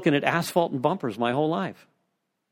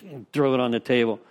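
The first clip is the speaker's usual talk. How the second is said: ordinary talk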